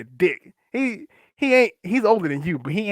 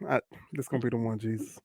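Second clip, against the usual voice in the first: low, soft, quick grumble to oneself; acted-out private resignation, barely voiced; weary dread; 5 semitones lower, 15 dB quieter, faster than usual. Worker sees front desk calling, quietly dreading it. Dreading the ringing office phone.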